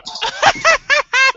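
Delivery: put-on high drunken witch cackle; witchy cackle. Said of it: "request for a Gak laugh".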